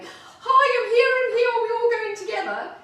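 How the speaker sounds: imitating an over-excited spaniel, high and loud; super excited. Voicing the spaniel arriving for her walk, super excited. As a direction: squeal it out like a thrilled dog bounding in. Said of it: "she came in super excited going".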